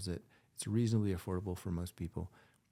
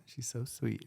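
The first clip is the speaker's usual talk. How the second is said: ordinary delivery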